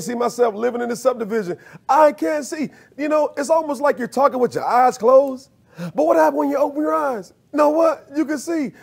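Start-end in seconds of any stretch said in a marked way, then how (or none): 1.9-2.7 raised, pleading I can't see; mock doubting voice. Preacher mocks doubting believer. 3.0-8.7 high, ringing preacher voice; fiery preaching. Real, not acted. Preaching at full force, raised.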